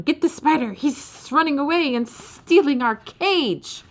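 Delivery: high, slow creature cry of alarm; snooze shouting alarm. Acted. Snooze guard shouting that the spider escaped, high and slow. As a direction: yell to raise the alarm.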